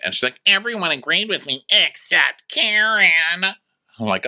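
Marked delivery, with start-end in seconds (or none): 0.5-3.5 higher, slow put-on voice; lightly mocking whine. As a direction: say it like a smug tattletale. Mia voiced in story.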